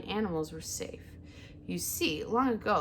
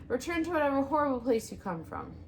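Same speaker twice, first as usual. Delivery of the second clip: squeaky weasel voice; 7 semitones higher, faster than usual. Acted. Frightened weasel continues.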